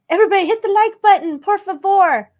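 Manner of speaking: high, loud and quick, urging everyone; rally the viewers to hit like; bouncy sign-off plea; cheery call to viewers. Real, not acted. Asking viewers to like video.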